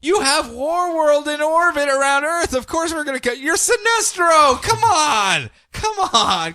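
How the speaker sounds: high, exasperated scolding voice; exasperated Wonder Woman. Acted. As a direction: chide him like a tired hero. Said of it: "she's going".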